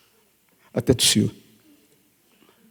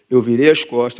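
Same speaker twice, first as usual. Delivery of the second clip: normal talking voice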